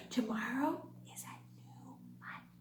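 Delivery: secret whisper to the class. Acted. Teacher whispers a secret to kids.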